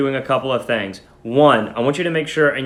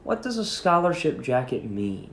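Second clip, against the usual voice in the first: a soft, hushed question, much softer; quietly asked; 8 dB quieter than usual. A narrator's reading. Grandpa quietly asking Martha about the jacket, soft and hushed. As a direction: ask it gently under your voice. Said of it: "quietly asked".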